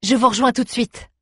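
high, light call. A narrator's reading. Young woman promises friends to follow, calling out high. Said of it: "lança la jeune fille".

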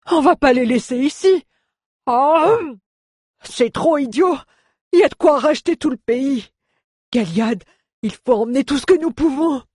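very high, wailing dwarf voice; dwarf's whining lament. A narrator's reading. Dwarf begging his carrier to take the gold, whining. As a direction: plead and wail over the treasure. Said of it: "se plaignit le nain en hoquetant".

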